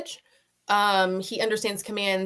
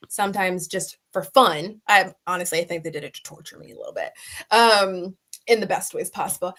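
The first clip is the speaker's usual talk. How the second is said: ordinary talk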